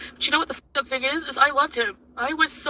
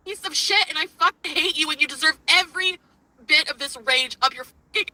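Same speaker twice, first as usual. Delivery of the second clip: raging, higher-pitched tirade; angry rant; 4 semitones higher than usual. Real, not acted. Woman raging at her cheating partner, angry. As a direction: spit out the insults in real anger.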